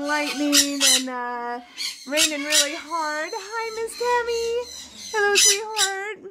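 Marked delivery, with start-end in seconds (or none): 3.3-6.2 high, sweet sing-song; pet-talk greeting. Real, not acted. Owner greeting Miss Tammy, cooing. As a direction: coo a hello, pitched high.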